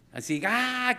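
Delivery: high, loud mock tantrum cry; a child's howl in the store, half-acted; imitated tantrum wail. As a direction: squeal like a kid throwing a fit.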